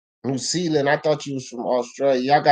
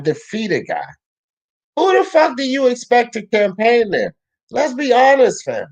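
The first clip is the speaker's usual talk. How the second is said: higher, heated and insistent; heated rant; 8 semitones higher than usual. Real, not acted. Streamer arguing boxing with his chat, heated and insistent. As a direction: argue it hard, pleading with the chat to be real.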